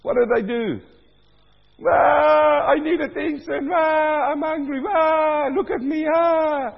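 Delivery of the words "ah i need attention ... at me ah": high, loud imitated crying baby; mock baby wail. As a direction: wail like a hungry newborn demanding attention.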